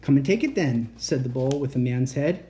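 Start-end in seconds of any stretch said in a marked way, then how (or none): none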